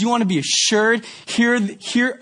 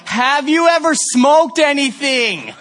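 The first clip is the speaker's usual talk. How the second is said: slower, higher, louder over bus noise; loud slow repeat; 7 semitones higher, 4 dB louder, slower than usual. Retold or imitated. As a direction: spell it out loudly for someone who can't hear. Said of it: "maybe you can't hear me. The bus is loud".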